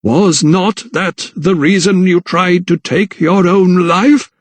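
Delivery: slow, high, probing question; drawn-out rising accusation; accusing vicar. A narrator's reading. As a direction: needle the victim, stretching each word.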